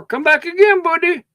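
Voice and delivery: high, sing-song clerk farewell; mock the shopkeeper's fake goodbye; mock condescending clerk. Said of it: "That's condescending to them".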